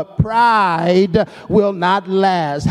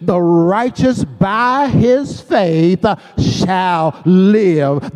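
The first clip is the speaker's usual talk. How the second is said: slow reading, landing hard on SHALL LIVE; deliberate verse reading, weight on "shall live"; emphasis on SHALL LIVE; much slower than usual. Shown as a demonstration. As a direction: stretch it out and set "shall live" apart. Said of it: "we should read this verse like this".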